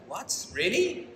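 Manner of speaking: rising disbelief, high on really; a real jolt of surprise; shooting up into a surprised squeak. Real, not acted. Hearing the cat was seen.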